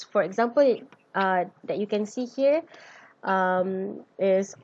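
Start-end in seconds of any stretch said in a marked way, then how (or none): none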